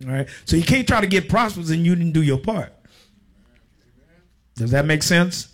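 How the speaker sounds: ordinary talk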